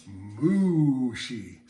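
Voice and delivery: low, drawn-out moo on mushi; slow mooing cow. Pretend. Steak sushi pun on moo.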